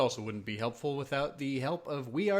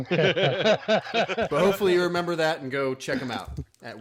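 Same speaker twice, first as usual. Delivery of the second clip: louder, laughing through the words; 8 dB louder than usual. Real, not acted. Host laughing after botching the sponsor plug, laughing.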